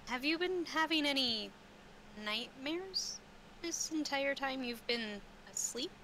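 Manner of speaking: normal talking voice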